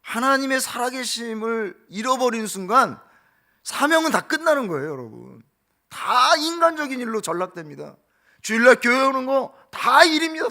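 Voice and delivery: high, loud fervent preaching; rising to a fervent preaching climax; preaching at full force. Acted. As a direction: drive it home to the congregation.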